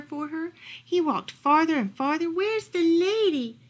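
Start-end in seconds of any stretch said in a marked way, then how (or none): 2.3-3.6 high, drawn-out searching question; searching dog. A narrator's reading. Lost dog walking farther and farther, searching. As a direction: stretch the question out, pitched up.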